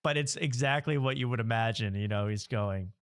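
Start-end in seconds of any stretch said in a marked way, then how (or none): none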